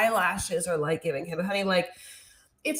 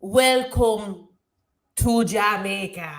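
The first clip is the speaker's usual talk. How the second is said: halting, word-by-word stab at Jamaican; attempted Jamaican accent; slower than usual. Shown as a demonstration. Repeating after co-host, halting attempted Jamaican accent. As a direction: try Jamaican slowly, breaking after the first word. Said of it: "cannot do a Jamaican accent".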